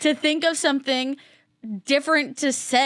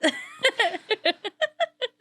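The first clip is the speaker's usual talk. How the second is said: high laugh; 11 semitones higher than usual. Real, not acted. Laughing at the sigh face.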